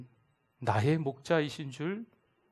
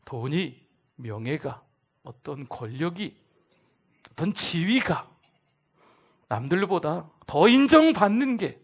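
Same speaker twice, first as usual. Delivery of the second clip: ordinary talk, nothing marked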